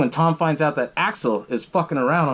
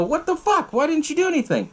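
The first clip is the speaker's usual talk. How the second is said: acted pissed off; 13 semitones higher than usual. Angry crew confronts the man about Tom, indignant. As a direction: imitate angry disbelief with voice shooting up. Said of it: "they get all pissed off".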